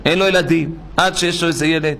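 ordinary talk, nothing marked

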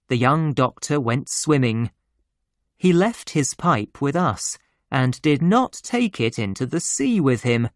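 ordinary delivery

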